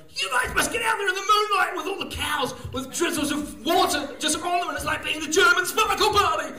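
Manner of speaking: high, euphoric rallying cry; hyped-up party shout. Acted. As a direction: whip the crowd up like a raver. Acts out himself hyping up farmers.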